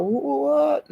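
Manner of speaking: high-pitched mock disbelief; shocked what. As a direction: react as if stunned by gossip. Acting out own shocked reaction.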